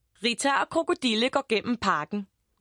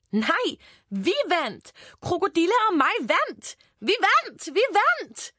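high victory cry, building higher; jubilant; 8 semitones higher than usual. A narrator's reading. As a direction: crow the win with mounting glee. Rita realises the two won. (jublende sejrsråb)

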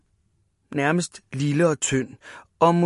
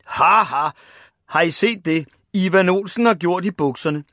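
loud mocking taunt; deep rusty jeer; 3 semitones higher, 4 dB louder than usual. A narrator's reading. Big boys mocking Ivan's trousers, jeering. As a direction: crow over his wet trousers. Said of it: "med deres dybe, rustne stemmer".

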